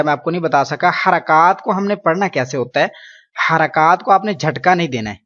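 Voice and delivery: usual speaking voice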